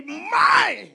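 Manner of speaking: high, loud prayer shout; re-enacted shout. Preacher recalling this morning's prayer, shouting. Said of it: "I shout".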